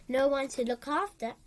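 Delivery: plain reading voice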